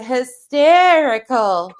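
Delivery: HYSTERICAL drawn out slowly and loud; mocking emphasis. Real, not acted. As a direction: stretch out "hysterical", punched high. Mocking what Brittany finds funny.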